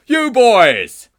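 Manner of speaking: huge high bellow, falling at the end; booming teacher's shout; thunderous yell. Acted. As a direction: explode at them like a furious teacher. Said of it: "yelled a voice like an elephant exploding in a tunnel".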